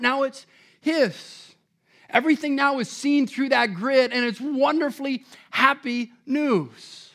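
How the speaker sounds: normal talking voice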